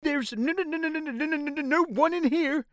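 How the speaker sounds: very high, panicky stuttering giant; nervous stammer. A narrator's reading. Poor nervous BFG denying anyone is here, stammering. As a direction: stick on the first sound, stammering in a high voice. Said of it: "he stammered".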